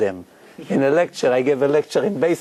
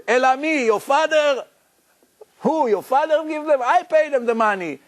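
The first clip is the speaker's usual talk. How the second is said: much higher, indignant retort; angry mafia boss; 11 semitones higher than usual. Retold or imitated. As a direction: fire back at him, outraged. Mafia man shouting about salaries.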